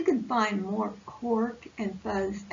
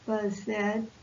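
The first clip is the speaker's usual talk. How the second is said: usual speaking voice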